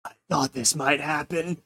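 faint, fading dying whisper; weak voice. Acted. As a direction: barely get the words out. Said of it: "in a very weak voice".

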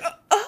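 high little gasps before a sneeze; imitated pre-sneeze gasp. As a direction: imitate a kid about to sneeze. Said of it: "like, a little kid about to sneeze".

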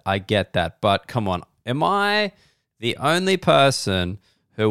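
ordinary talk

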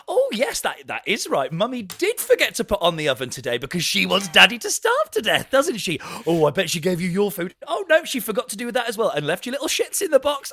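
passive-aggressive sing-song pet voice; talking to the cat. Retold or imitated. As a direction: coo at the cat while sniping at your partner. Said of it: "we talk to the cat about it".

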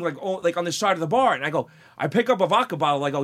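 ordinary talk, nothing marked

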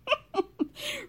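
real high squeal of laughter; high-pitched laugh. Real, not acted. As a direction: break into a high, amused laugh. Amused at the ready clip.

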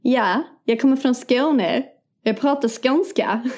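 slow, broad Scanian; Skåne accent. Acted. Quizzing co-host on the dialect, Skåne accent. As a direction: put on a drawn-out Scanian dialect. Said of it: "gissa vad det är för dialekt".

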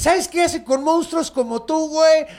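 high, loud put-on voice of a swaggering brawler, laughing through it; cocky Enkidu voice. Enkidu taunting the forest monster, high loud mocking brag. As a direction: brag and taunt like a cartoon tough guy. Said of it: "Y pinche Enkidu".

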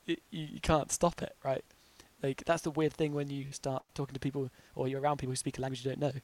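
ordinary talk, nothing marked

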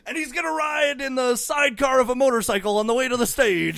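loud, high hype shout; hype announcer. Pretend. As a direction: build the crowd up. Hyping wrestler entrance.

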